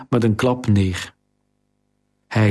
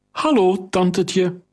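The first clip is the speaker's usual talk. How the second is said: cheeky greeting; 8 semitones higher than usual. Acted. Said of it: "De jongen grijnsde".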